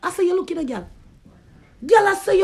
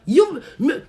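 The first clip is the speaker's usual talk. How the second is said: ordinary delivery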